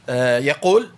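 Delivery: usual speaking voice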